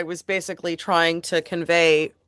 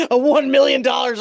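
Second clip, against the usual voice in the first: loud, raised villain ransom demand; Doctor Evil; 8 semitones higher, 6 dB louder than usual. Acted. Joking to co-host about a villain's demand, loud hammed-up impression. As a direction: belt out the sum as a comic movie villain. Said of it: "I feel like Doctor Evil".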